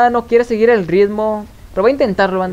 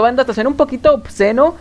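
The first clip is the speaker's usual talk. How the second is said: usual speaking voice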